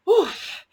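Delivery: high, loud grunt on landing; knocked breathless by the fall; winded oof. A narrator's reading. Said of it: "he gasped".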